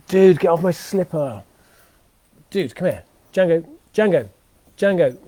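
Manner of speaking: calling the puppy off. Real, not acted. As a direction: call him off the slipper, firm.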